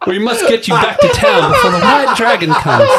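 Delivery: high, loud, laughing bird voice; urgent bird voice. A narrator's reading. Bird companion urging the hero back, high and loud. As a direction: urge them home in the squawky bird voice.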